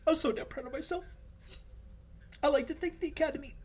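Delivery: very high, squeaky put-on voice; mock acceptance speech. Hobbyist proud of own work, mock award speech. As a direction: play an overcome award winner in a high voice.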